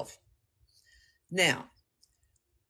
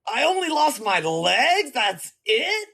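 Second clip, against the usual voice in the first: higher, slow disbelief; incredulous; 7 semitones higher, slower than usual. Acted. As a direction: ask it in amused disbelief. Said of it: "And you're like".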